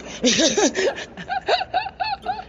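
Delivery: a long, high burst of laughter; big high laugh. Real, not acted. Telling her audience about her husband, high laughing burst. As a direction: laugh out high at your own punchline.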